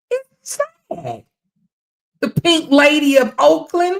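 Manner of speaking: outraged rant. Real, not acted. Ranting at women in her chat.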